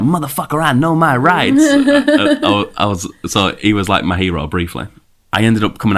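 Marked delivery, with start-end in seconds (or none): 0.0-1.6 higher, slower, defiant; defiant outburst. Retold or imitated. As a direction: throw it back at them like a rebel.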